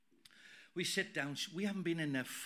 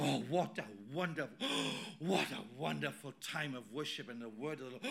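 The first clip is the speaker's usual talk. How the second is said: mock rapture; 5 semitones lower, 7 dB quieter than usual. Mimics gushing churchgoer after the service.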